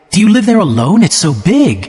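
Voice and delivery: excited. A narrator's reading. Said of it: "she cried excitedly".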